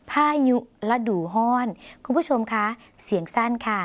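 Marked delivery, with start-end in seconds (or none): 0.1-1.7 "พายุฤดูร้อน" repeated as Lao pha-nyu la-du hon; teacher models "พายุฤดูร้อน" in Lao, ny for y; Lao pronunciation. Shown as a demonstration. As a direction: say "พายุฤดูร้อน" with nasal nyu and an h in ร้อน. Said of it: "ออกเสียงขึ้นจมูกเป็น".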